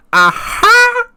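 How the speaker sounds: a high, loud whooping "Aha!"; mock battle cry. Wrestler mocking a rival with a loud high "Aha!". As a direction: yelp it like a showman high-flyer. Ricochet's signature cry retold.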